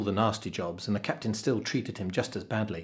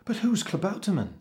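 put-on high young boy's voice; puzzled cabin boy; 10 semitones higher than usual. A narrator's reading. Cabin boy asks the cook about the guest, puzzled. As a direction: ask it in high-pitched puzzlement.

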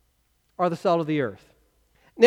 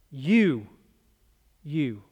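accusing you; 6 semitones lower, 5 dB quieter than usual. Shown as a demonstration. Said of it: "You just see a finger pointing at you".

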